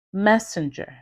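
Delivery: isolated words, each with one clearly stressed syllable, ARchitect, eLECtion, transMISsion; word stress demo. Shown as a demonstration. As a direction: stress Architect, messenger, indicate, uniform up front, election and transmission in the middle. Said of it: "Notice how I put an emphasis".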